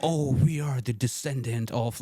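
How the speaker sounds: slow, drawn-out mock grandeur; mock self-important. Podcaster mimicking a proud Sayyid explaining descent, mock self-important. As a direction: imitate someone solemnly proud of their lineage.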